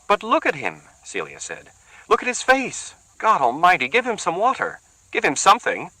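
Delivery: high urgent woman's voice; pleading woman. Acted. Celia begs the others to help Gerardo, high and urgent. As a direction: beg them to help him.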